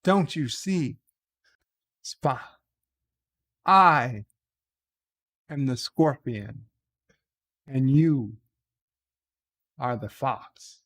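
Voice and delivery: lightly voiced theatrical reveal, long pauses; mock-grave declaration in broken phrases; Captain Kirk. Captain standing over his dying officer, mock dramatic. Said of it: "Kirk goes over him and says".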